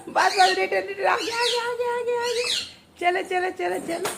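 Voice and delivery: put-on high, light sing-out to a child; playful urging. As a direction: urge a little driver on in a high, soft voice. Egging child on during toy ride.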